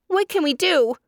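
high, loud frightened cry; a trapped dove calling in fear. A narrator's reading. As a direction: panic in a small voice. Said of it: "cried a dove".

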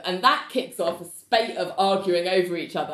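ordinary delivery

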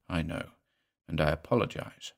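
soft, low, patient apology; softest tone. A narrator's reading. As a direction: placate her patiently and very gently. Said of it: "in the softest tone of voice".

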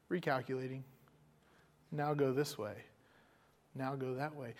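lightly voiced navigation voice, soft and even; calm GPS voice. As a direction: read it like a patient sat-nav, hushed. Imitating GPS voice.

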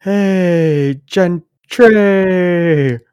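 drawn-out high heckler call; imitated yelling. Heckler calling to right fielder from the stands, imitated yell. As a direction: call it out long, as if across the outfield. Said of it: "just yelling".

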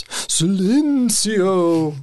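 slow, high, drawn-out spooky moan; ghost voice. Shown as a demonstration. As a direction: stretch it out like a ghost. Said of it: "in a ghost voice".

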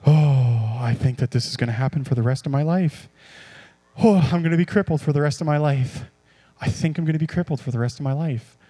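low, sighing self-pity; mock despair. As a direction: act out a hopeless, defeated patient. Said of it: "my excitement is not".

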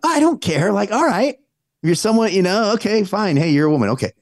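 high-pitched, shrugging concession; dismissive acceptance. Acted. Acting out indifferent acceptance of request.

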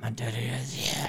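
put-on low, hushed possessed-child voice; creepy voice. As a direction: breathe it like a haunted little girl. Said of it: "I heard it more, like, in a creepy voice".

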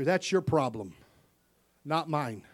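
usual speaking voice

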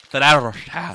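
loud hammed-up Italian brand name; mock Italian accent. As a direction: belt out the name like a stage Italian.

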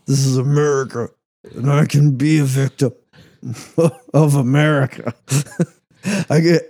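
mock-proud American bluster; mock patriot. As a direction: declaim it as a parody patriot, breaking into laughs. Mocks patriot victimhood, laughing through it.

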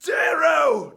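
high, very loud shout; calling out. Acted. Recording Severo's call to Darrow, high loud shout. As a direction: holler the name. Recording a called-out character line.

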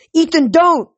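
high, loud plea to stop; urgent shout. A narrator's reading. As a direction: cry out to halt a friend. Hero rushes in to stop Ethan.

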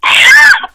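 loud high-pitched laugh at a pun. Real, not acted.

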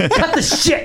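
very high, strained protest; mock furious squeal. Broadcaster sniping at his partner during a silence, mock furious. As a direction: squeeze it out high, close to laughing.

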